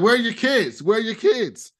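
lightly hammed repeated jab; imitating a taunt. Logan needling Tom about his kids, lightly mocking. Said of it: "he's like".

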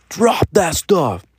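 high, slow stern command; imitating the stern dad; dad's order. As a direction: order the kid to drop it. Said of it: "And he's just like".

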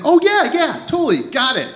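lightly mimicked girl, high and eager; quick high-pitched reassurance, imitating her; over-agreeable girl. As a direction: play her too keen to agree. Voicing girl asked for ID.

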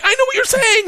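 very high, delighted cry; giddy excitement. Retold or imitated. Student understanding gangster's Russian, elated. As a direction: exclaim it, thrilled to understand.

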